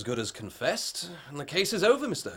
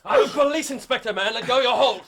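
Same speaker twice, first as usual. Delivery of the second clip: higher, louder commands mid-struggle; straining shout; 10 semitones higher, 5 dB louder than usual. Acted. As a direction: bark it while wrestling him down. Inspector grappling with the harpooner.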